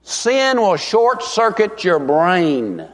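slow and deliberate, each word set down; slow, weighty warning. Real, not acted. Preacher warning his flock slowly. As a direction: lean on it slowly so it sinks in. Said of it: "you listen real carefully".

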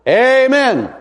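a hearty, drawn-out amen. Retold or imitated. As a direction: call out amen with full heart. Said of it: "I always say a hearty".

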